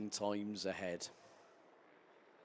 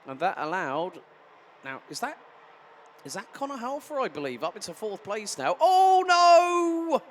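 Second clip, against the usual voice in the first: live play-by-play; 3 semitones higher, 6 dB louder than usual. Real, not acted. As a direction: call every overtake with urgency.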